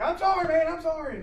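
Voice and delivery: high-pitched, imitated flustered apology; frantic apology. Student medic apologizing to shocked patient, frantic.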